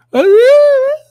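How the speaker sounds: sudden high laugh; a squeaky laugh at the clip; high burst of laughter. Real, not acted.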